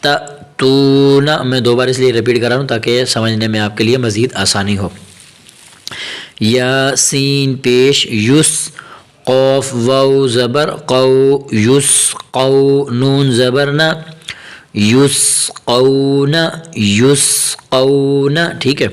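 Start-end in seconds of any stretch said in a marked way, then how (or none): 0.0-1.4 measured letter-by-letter chant; teacher's drawn-out recitation; Quranic spelling recitation. Shown as a demonstration. Spells out Quranic words for learners. 6.4-18.5 slow, drawn-out syllable chant; teacher reciting slowly for learners to follow; slow Quranic spelling recitation. Shown as a demonstration.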